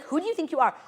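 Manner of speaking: high-pitched mock scolding; yelling customs agent. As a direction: imitate an indignant official. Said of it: "he was yelling at me".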